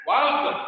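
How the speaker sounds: "Walk" with a clearly sounded L, high and loud; Indian-accent L. Shown as a demonstration. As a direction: punch out "Walk" Indian-style, L pronounced. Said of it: "As per Indian accent, how will you pronounce?".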